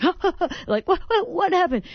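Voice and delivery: high, loud, breathless excitement; excited coach-like cry. Retold or imitated. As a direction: yell like a thrilled football coach. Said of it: "standing up like a football coach".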